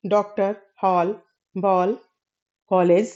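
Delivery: डॉक्टर, हॉल, बॉल, कॉलेज with an open ऑ, between आ and ओ; slow, careful open ऑ in हॉल and बॉल; English ऑ sound. Shown as a demonstration. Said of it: "बोली जाने वाली जो अ ध्वनि है". (ऑ ध्वनि का स्पष्ट उच्चारण)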